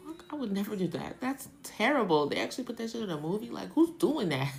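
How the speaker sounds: high, loud outraged disbelief; hammed-up shocked viewer; mock disbelief. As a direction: react like the movie is absurd. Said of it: "I'd be looking at the screen like".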